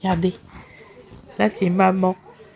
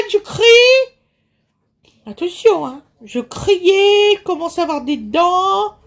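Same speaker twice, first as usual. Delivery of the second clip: voicing the baby; 8 semitones higher than usual. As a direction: speak as the baby introducing himself. Mother speaks as the baby.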